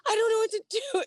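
very high, whiny squeak; lost young sailor whining to himself; whiny helpless self. Retold or imitated. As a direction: whimper it like a lost kid.

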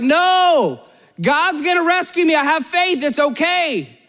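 imitating a man adamantly waving off rescue; stubborn refusal. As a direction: refuse help with hammed-up certainty.

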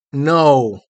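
loud, sullen contradiction; sulky denial. A narrator's reading. Frightened boy contradicts the girl, sulkily. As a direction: deny it emphatically, in a sulk. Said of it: "he said sulkily".

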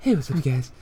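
half-hearted vlogger greeting; a failed try at the greeting; weak Leafy impression. Friend trying the YouTuber's greeting, half-hearted. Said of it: "I can't really do it".

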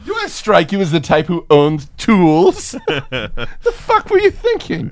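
high, put-on indignant voice, breaking into a laugh; mock victim retort. Victim mocks the robber for expecting tools, high and laughing.

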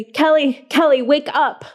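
frantic repeated shout. Acted. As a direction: shout to wake her, panicked. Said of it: "shouted repeatedly".

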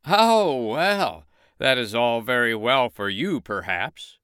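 high, loud boastful rooster; crowing proud rooster. Acted. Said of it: "said the rooster".

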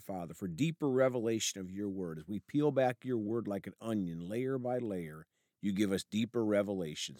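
soft, hushed prayer voice; hushed pastoral prayer, reverent. Acted. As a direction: pray quietly and humbly to God. Pastor leads opening prayer.

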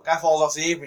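usual speaking voice

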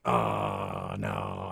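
low, drawn-out groan of dread; sinking, reluctant dismay; mock dread. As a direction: let it sink low as you realize what's coming. Acted dread at maid ritual.

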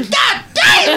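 very high, laughing yell of delight; excited shout. Retold or imitated. Fan cheering the Falcon's wings, laughing shout. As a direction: whoop it like a thrilled fan. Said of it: "every time the wings go".

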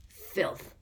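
gruff growled insult; growling. A narrator's reading. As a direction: spit the word like a growl. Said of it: "a familiar growling voice".

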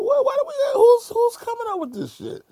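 high-pitched mock disbelief; baffled protest. Actor retelling his complaint to Cube, high-pitched bafflement. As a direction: shoot the pitch way up, incredulous.

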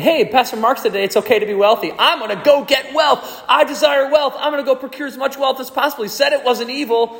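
high, fast, giddy voice of a greedy listener; mock eager churchgoer. Preacher mocking a listener who wants wealth, high and giddy.

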